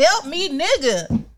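slow, drawn-out begging; mock helpless plea. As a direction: plead like someone out of their depth. Mocking helpless partner.

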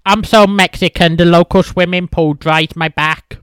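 shouting the punchline; loud joke delivery. Real, not acted. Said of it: "fuck it, I'll tell the joke".

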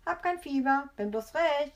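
put-on high, loud childish crowing; gleeful, high-pitched kid; jubilant. A narrator's reading. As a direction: cheer it out cheekily. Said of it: "und jubelt los".

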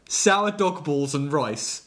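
slow, each word punched; punching every word. Shown as a demonstration. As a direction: savour each word like a title. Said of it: "is our title".

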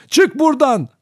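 very high, loud scream; full-force shout. A narrator's reading. Child lunging at the old woman, screaming at full force. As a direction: scream to drive the intruder out. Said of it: "Bütün gücümle bağırarak üstüne atıldım".

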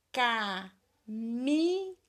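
"Caminhão" very slow, in drawn-out syllables. Shown as a demonstration. Teacher dictating to pupils who look for digraphs, syllable by syllable. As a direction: stretch and separate each syllable. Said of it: "Separa as sílabas".

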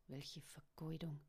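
soft, low muttered grumble. A narrator's reading. Father laments the waste, muttering quietly. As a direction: drop pitch and volume, grumble under your breath. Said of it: "murrte er leise".

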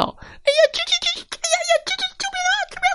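老鼠吱吱求救; squealing mice. A narrator's reading. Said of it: "老鼠被打得吱吱叫".

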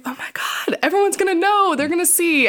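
whispered gasp, then rising panic; panicked. Retold or imitated. Dreading friends learning her secret, panicked whisper rising high. Retold panic over exposure.

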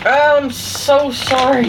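drawn-out helpless apology; laughing apology. Real, not acted. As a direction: apologize while cracking up. Laughing over wrong bag opened.

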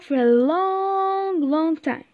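storyteller's slow, lengthened "long"; drawn-out LONG. A narrator's reading. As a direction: draw out "long" to show the length. Storyteller stretches the word long.

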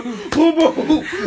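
high, loud banging sounds; mock door pounding. Friend acting out naked man at door, loud booms. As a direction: pound out each boom.

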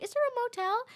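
high, sing-out asking voice; imitated over-sweet inquiry; put-on polite question. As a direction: ask it way up high. Acting out her question to lady.

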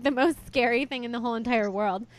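ordinary talk, nothing marked

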